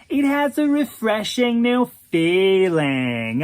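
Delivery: slow drawl, lifted pitch; airy drawl. Acted. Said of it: "in that airy drawl of his".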